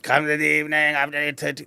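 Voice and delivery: put-on raspy voice of a smoking auntie; raspy auntie impression. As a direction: imitate a smoky-voiced mother on the phone. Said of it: "auntie spoke like this".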